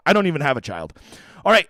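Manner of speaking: usual speaking voice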